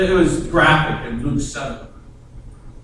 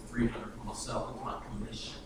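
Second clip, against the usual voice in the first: sly aside; 8 semitones lower, 18 dB quieter than usual. Acted. Tax collector pocketing his cut, sly hushed aside. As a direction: murmur it to yourself slyly.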